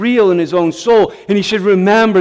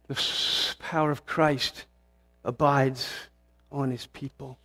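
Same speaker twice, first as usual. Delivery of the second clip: much softer, nearly breathed on the first word; hushed reverence; 4 semitones lower, 12 dB quieter than usual. Real, not acted. Preacher whispering that Christ's power rests on his people. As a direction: fade it down like a prayer.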